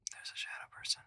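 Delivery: hushed, breathy whisper at a party. Retold or imitated. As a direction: whisper it so others won't hear.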